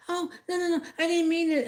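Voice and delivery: high, hurried denial of a caught woman; mock her high, flustered backpedal. Vlogger imitating the woman behind her in line, high and flustered. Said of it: "she's like".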